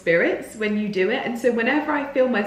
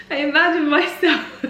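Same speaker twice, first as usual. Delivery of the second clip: giggling through the words, pitched higher; laughing; 8 semitones higher than usual. Real, not acted. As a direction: let the laugh break through. Laughing while explaining silly trick.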